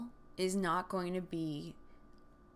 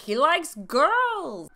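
loud, high, drawn-out cartoon Russian accent; bad Boris and Natasha accent; 9 semitones higher, 12 dB louder, much slower than usual. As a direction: belt it out slowly in a hammy Russian accent. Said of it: "like, Boris and Natasha accent".

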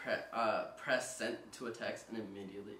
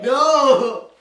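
much higher, shouted no; loud denial; 12 semitones higher, 20 dB louder than usual. Real, not acted. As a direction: cry out a protest at being accused. Loud denial of lying accusation.

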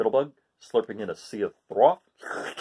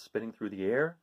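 usual speaking voice

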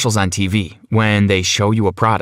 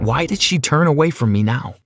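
ordinary delivery

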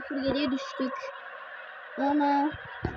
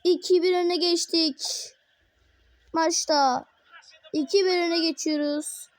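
higher, energetic play-by-play; football commentator; 7 semitones higher, 5 dB louder, slower than usual. Acted. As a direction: narrate every attack like live TV. Calls the match play-by-play.

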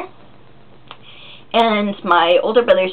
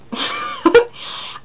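squeaky embarrassed giggle; high squeaky laugh. Real, not acted. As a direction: burst into a shrill giggle. Laughs at herself.

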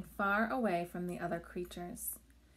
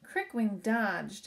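plain reading voice

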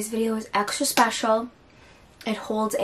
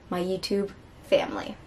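whispering; 2 semitones lower, 6 dB quieter than usual. Real, not acted. Hushed aside to viewers.

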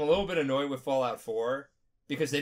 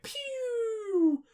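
mock missile pew. Nukes launching, imitated.

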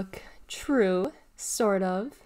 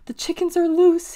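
high, louder alarmed squeak; 9 semitones higher, 8 dB louder than usual. A narrator's reading. Clayton warning of escaped chickens, squeaked. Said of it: "he squeaked".

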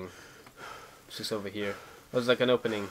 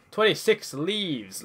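excited, slow; 6 semitones higher, 3 dB louder, much slower than usual. Real, not acted. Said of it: "repeats the count".

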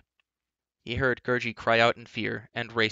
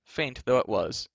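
ordinary delivery, nothing marked

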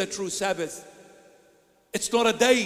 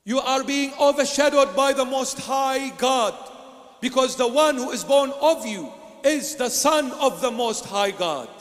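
fervent preaching; 4 semitones higher, 4 dB louder than usual. Acted. Fervent preaching about Christ.

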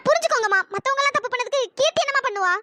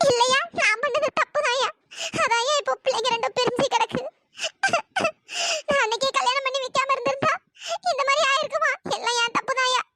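sobbing self-blame; tearful guilt, halting between sobs; crying. Acted. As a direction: lament in tears, pausing to sob. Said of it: "அழுகாதீங்க".

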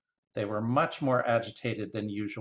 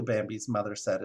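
ordinary delivery

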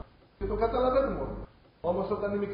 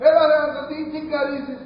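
loud, higher, snapping retort; wife yelling back; 5 semitones higher, 6 dB louder than usual. Retold or imitated. Wife yelling back at her husband in the quarrel, loud and higher. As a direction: shout back at him, fed up. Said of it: "Ακούει τη γυναίκα".

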